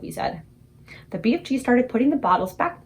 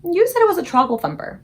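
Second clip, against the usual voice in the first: put-on a high, louder little girl correcting him; Sophie objecting; 8 semitones higher, 5 dB louder than usual. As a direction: pick him up on the word.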